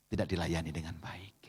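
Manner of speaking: unvoiced whisper; whispered sermon emphasis; sudden whisper. Real, not acted. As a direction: hush it for weight. Sermon point dropped to a hush.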